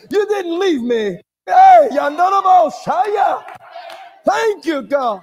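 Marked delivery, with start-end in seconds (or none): 1.5-3.4 shouted tongues. Real, not acted. Praying in tongues.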